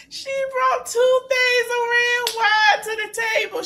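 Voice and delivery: helpless high-pitched telling; cracking up. Real, not acted. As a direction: squeal the words out through laughter. Cracking up while recapping.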